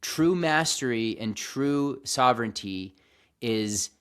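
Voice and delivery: ordinary delivery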